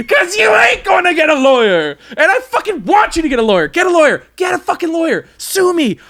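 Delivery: high-pitched shouted dare; goading rant. Acted. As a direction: goad her at the top of your range. Ranting dare to sue.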